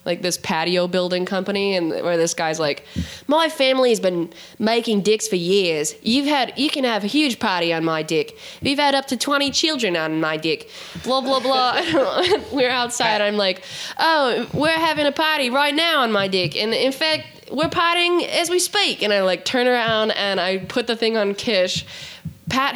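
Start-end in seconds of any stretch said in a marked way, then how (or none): 3.3-10.7 lightly put-on Australian ad voice; hammy Aussie salesman pitch; mock Australian commercial; Australian accent. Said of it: "fake Australian commercial". 14.0-19.0 higher, put-on Australian voice; party-boast in a mock Aussie accent; hammed-up Australian party talk. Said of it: "my Australian accent".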